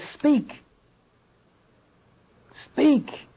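high-pitched single word, falling away; imploring command. Acted. Ahab says it to the whale's head, imploring command. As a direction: pitch it up high and let it drop.

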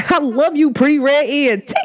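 hammed-up, high-pitched self-love; playful sass. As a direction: draw it out with teasing, sing-song emphasis. Playful exaggerated self-love affirmation.

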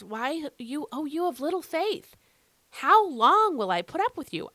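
acted rebuke rising to a loud "how long"; exasperated rebuke. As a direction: scold the doubters in disbelief. Jesus rebuking disciples, acted out.

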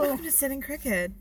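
soft, sultry spa host; slutty spa voice. Pretend. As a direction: purr it like a spa ad. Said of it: "it's like a slutty spa voice".

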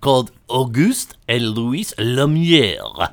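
slow mock French accent; hammed-up French, drawn out. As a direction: relish the French names. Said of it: "best French voice on for this".

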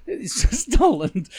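high, drawn-out mock repeat with laughter; laughing, incredulous parroting; mocking echo. Real, not acted. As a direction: scoff it back in a high voice. Mocks friend's excuse about lost phone.

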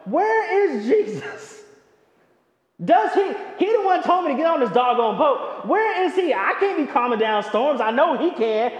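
high, strained pleading cry; panicked disciples. Retold or imitated. Preacher voicing scared disciples on the boat, high and pleading. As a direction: voice the frightened disciples calling for Jesus. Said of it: "I would imagine they're going".

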